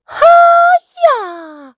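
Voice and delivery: very high, loud martial-arts cry; kid's karate yell; ninja shout. Acted. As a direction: yell it as you leap in. Ninja kick into pool.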